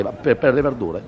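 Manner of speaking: ordinary talk, nothing marked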